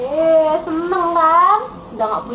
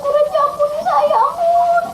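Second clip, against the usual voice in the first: high, wailing plea for mercy; acted crying; 11 semitones higher, 3 dB louder than usual. Servant crying before the king for mercy, high wail. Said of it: "Tuh dia menangis".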